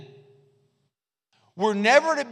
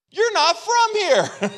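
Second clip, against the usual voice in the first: much higher, slow marvelling voice on You're not from here; awestruck onlooker; 11 semitones higher, 4 dB louder, slower than usual. Retold or imitated. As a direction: lift it high and stretch it out like someone amazed. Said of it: "people ought to look at our life and say to us".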